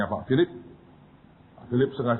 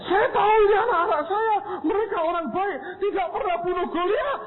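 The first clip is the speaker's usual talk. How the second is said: pleading teacher. Retold or imitated. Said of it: "dosen membela murid".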